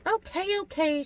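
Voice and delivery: high, light voice; airy high-pitched okay; giving in. A narrator's reading. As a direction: concede lightly, pitched well up. Alice gives in to whining.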